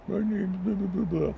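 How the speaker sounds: high sneering mock voice; mocking dismissive coworkers. Coworkers dismissing the young barista, high mocking parody. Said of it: "they were fucking being".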